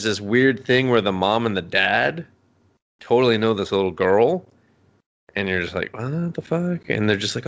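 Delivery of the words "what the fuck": higher-pitched mock bewilderment; baffled disbelief. Viewer reacting to parents knowing the girl, mock bafflement. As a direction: act thrown by an absurd twist.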